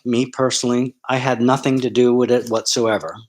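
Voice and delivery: ordinary talk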